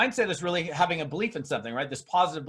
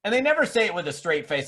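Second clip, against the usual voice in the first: ordinary delivery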